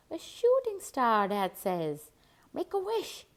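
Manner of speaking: a father thrilled, pointing at the sky; delighted exclamation; excited dad. Acted.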